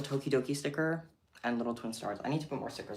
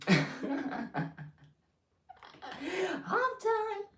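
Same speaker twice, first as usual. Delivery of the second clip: excited squeal. Real, not acted. Squealing after receipt.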